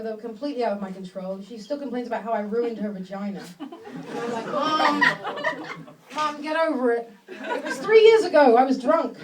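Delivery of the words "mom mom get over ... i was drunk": louder, exasperated "Mom, get over it"; mock exasperated. To complaining mother, loud exasperated brush-off. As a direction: brush off the nagging mother loudly.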